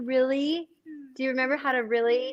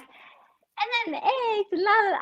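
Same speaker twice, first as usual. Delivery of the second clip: higher, slow, airy sing-song; clueless sing-song; 9 semitones higher than usual. Retold or imitated. Dad mimicking his clueless self, higher sing-song. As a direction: play dumb, lightly singing la la la. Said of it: "I'm just like".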